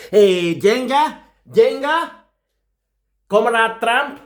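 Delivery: calling out. Acted. Russian boss calling Comrade Trump, a high teasing call. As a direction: call him over like a pet.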